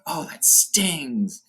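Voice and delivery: high, slow, drawn-out spider voice; stabbed spider. Retold or imitated. Spider stabbed by Bilbo, high slow pained whine. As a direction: whine it slowly like a wounded spider. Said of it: "the spider's like".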